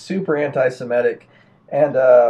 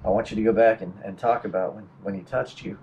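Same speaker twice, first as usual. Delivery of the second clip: calm therapist; 5 semitones lower, 5 dB quieter than usual. Retold or imitated. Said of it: "the therapist is like".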